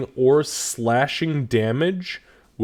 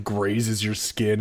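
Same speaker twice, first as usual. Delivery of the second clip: ordinary talk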